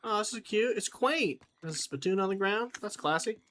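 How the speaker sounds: lightly voiced high cowboy accent; put-on accent. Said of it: "Sorry, my accent was showing".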